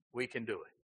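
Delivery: soft deflated echo; quiet echo. Acted. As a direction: let the boast sink to a hush.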